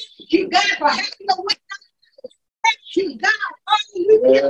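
loud, high, full-force praise shouts; fervent praise. Real, not acted. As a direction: cry out thanks to God at full power.

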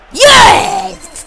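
high screamed celebration; explosive gamer cheer; triumphant shout. Real, not acted. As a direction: yell it as the goal goes in. Celebrating a goal.

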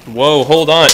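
ordinary talk

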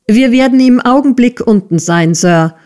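earnest, lifted reply to a superior; prompt reply. A narrator's reading. Pupil-teacher answers the bellowing master.

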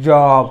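जॉब loud, with open "aw"; short O modelled. Shown as a demonstration. Teacher answering own question for students, जॉब loud and deliberate. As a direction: punch out जॉब as one word. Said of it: "कसं आहे".

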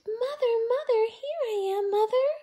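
high, loud joyful calling; loud happy chick cry; excited call. A narrator's reading. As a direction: run up shouting Mother. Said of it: "he said to the big thing".

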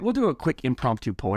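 ordinary delivery

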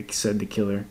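ordinary delivery

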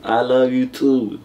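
drawn-out attempt at "I love you too"; slow and careful. Shown as a demonstration. Boyfriend retries for his doubting partner, slowly. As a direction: say it at half speed, stretching each word. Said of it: "Say it slow".

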